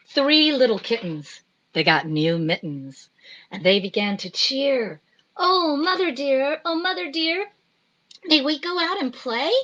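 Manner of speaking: lilting sing-song rhyme reading; reading to children. Acted. Reading the kittens rhyme to children, sing-song. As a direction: read it like a bedtime rhyme.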